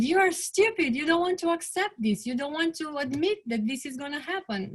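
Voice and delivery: retold anger. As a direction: replay her anger at Daddy, imitated not full.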